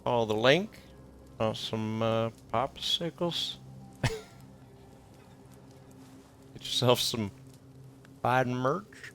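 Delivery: slow, drawn-out mock sponsor plug; mock ad read. Streamer plugging sponsor gifts in slow mock ad voice. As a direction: ham up a lazy ad pitch.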